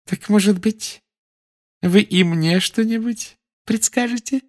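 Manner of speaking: high, sweet prim official; sickly sweet inquisitor; sugary high Umbridge voice. A narrator's reading.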